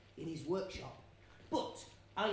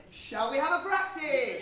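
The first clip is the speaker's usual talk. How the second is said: louder repeat; 3 semitones higher, 8 dB louder than usual. Real, not acted. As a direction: raise the voice on the repeat. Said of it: "I said".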